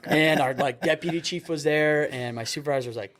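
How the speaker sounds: ordinary talk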